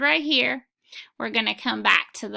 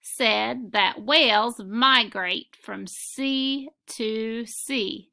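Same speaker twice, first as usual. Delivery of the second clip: slow dictation reading; much slower than usual. Shown as a demonstration. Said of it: "I'm going to read the next sentence".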